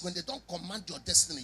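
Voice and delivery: usual speaking voice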